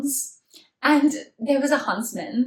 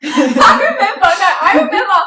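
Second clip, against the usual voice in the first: high, loud words through real laughter; cracking up, voice shooting up high; laughing recollection; 9 semitones higher, 8 dB louder than usual. Real, not acted. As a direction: burst out laughing as you insist on it. Cracking up recalling the spider night.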